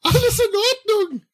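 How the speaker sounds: squeaky high helium voice; radio call on a lungful of helium. Acted. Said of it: "der Funkspruch hört sich aber komisch an".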